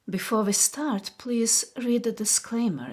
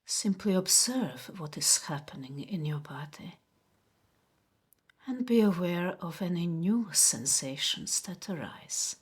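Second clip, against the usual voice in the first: guided meditation. Acted. Host guiding viewers to notice body sensations, guided meditation. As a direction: guide them gently inward, unhurried, leaving space after each phrase. Leads a relaxation exercise.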